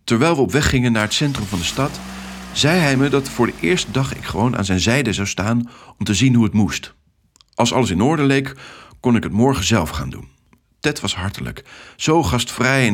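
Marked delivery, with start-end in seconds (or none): none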